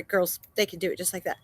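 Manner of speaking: plain reading voice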